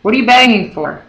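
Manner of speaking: shouted. A narrator's reading.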